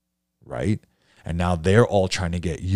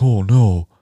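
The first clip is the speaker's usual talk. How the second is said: mock dismay; 4 semitones higher, 10 dB louder than usual. Painter realizing leads dried up.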